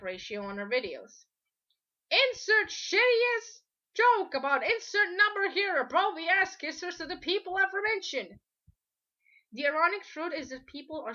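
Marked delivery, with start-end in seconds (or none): 2.1-8.4 loud mock announcement. Ranter mocking a joke to the people aforementioned, loud and high.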